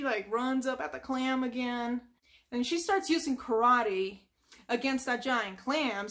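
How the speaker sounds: ordinary delivery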